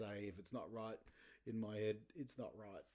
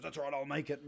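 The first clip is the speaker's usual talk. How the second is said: storming dad; 4 semitones higher, 7 dB louder, faster than usual. Retold or imitated. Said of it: "stormed in and goes".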